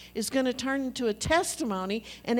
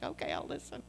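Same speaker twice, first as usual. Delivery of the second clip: whispered, much lower and softer; meek whisper; 7 dB quieter, faster than usual. Retold or imitated. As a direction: shrink down and whisper it. Meek child reply to mom.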